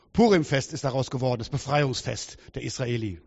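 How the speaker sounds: ordinary talk, nothing marked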